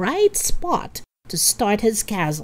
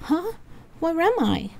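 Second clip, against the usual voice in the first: high, confused waking voice; bleary, just woken; 10 semitones higher, 3 dB quieter than usual. A narrator's reading. Miriam waking up in the car, high bleary confusion. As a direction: ask it as if just roused from sleep. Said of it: "Miriam woke up and looked out the window blearily".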